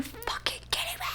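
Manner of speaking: whispered in disbelief; whispered aside of real annoyance at the book; exasperated whisper. Real, not acted. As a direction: hiss it under your breath in outrage.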